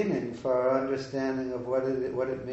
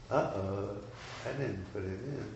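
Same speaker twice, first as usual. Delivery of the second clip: lower, softer retold confession; reluctant admission; 3 semitones lower, 7 dB quieter than usual. Disciple owning up to his spiritual master, sheepish quiet admission. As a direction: admit it quietly, under the voice. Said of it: "he had to admit it".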